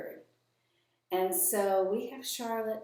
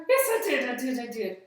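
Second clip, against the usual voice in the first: 1 eager puppet; 3 semitones higher, 6 dB louder than usual. Acted.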